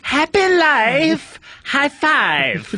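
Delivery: slow high sing-song chant. Acted. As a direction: stretch it out high and singsong.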